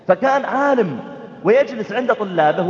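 normal talking voice

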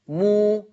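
مو on a steady elongated ū; long chanted مو; silah with waw. Shown as a demonstration. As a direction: hold مو out evenly as in recitation. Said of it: "بقول مو".

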